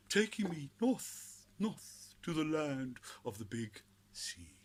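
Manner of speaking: lofty mock sermon of a flight north; mock Bushiri. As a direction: ham up a prophet's miracle tale. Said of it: "can you imagine what he's gonna say".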